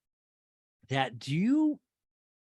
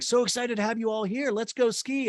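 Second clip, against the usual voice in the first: higher, mock-chirpy welcome; peppy instructor; 8 semitones higher than usual. Podcaster imitating ski instructor greeting group, lightly chirpy.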